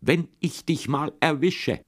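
high, slow, menacing call; threatening call. A narrator's reading. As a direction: shake the stick and call it out. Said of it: "hebt er immer seinen Stock und ruft".

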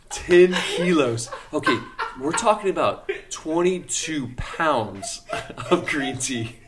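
cracking up at the huge amount; laughing. Real, not acted. Laughing retelling the huge order.

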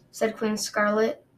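ordinary delivery

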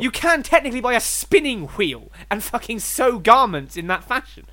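high, loud exasperated ranting; exasperated argument. Real, not acted. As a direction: hammer the point home in disbelief. Exasperated argument over spitting tobacco.

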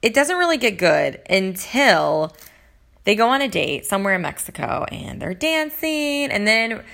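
ordinary delivery

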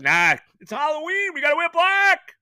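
high, loud sarcastic mimic of the owner; mock whiny owner. Fan host mocking the team owner's uniform choice, sarcastic high mimicry. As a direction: sneer it back like a spoiled owner.

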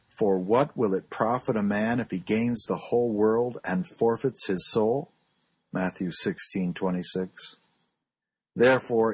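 ordinary talk

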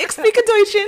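high, loud pretend German accent; mock German. Joking to laughing co-host that she's real German, playful German accent. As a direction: show off your put-on German. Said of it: "Real German, right?".

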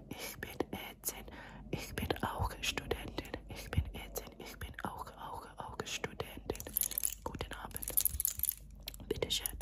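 soft, unhurried breath-only whisper; whispered German. Shown as a demonstration. Doctor testing patient's hearing, whispered German. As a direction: whisper German close to the ear. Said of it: "whisper some languages in your ear".

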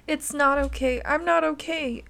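put-on a child breaking down in pain; anguished cry. A narrator's reading. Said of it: "Anna cried".

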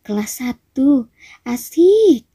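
gleeful taunt leaping up high; mischievous ghost. A narrator's reading. Naughty ghost spotting first-years, high mischievous glee. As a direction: start drawn-out, then squeal up with glee. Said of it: "katanya sambil tertawa nakal".

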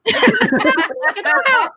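squealed high through laughter, slowly; laughing high squeal. Retold or imitated.

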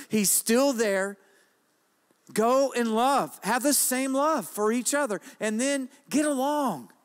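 loud, high, fervent preaching; rising revival preacher exhortation. Acted. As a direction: preach it out with full force. Preaching at full force.

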